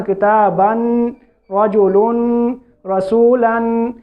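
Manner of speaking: slow کتابن, رجلن, رسولن, each ending on a clear n; audible final noon. Shown as a demonstration. Teacher listing tanween examples, audible final noon. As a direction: recite each word drawn out, landing on a plain n. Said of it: "یہ آخر میں نون ساکن کی آواز آ رہی ہے".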